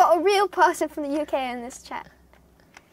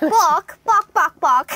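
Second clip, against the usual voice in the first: put-on loud British-accented bark; punchy mock-British barks; 6 dB louder, much slower than usual. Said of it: "You gotta bark in British".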